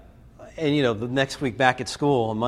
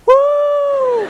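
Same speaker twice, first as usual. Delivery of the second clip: high, loud whoop; a big triumphant woo; crowd cheer; 10 dB louder than usual. Retold or imitated. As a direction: cheer like a thrilled class. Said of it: "the kids in the class went".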